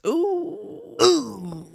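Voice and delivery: squealing ooh, high falsetto; hammy high-pitched ooh; high mock ooh. Reacting to ripped pants.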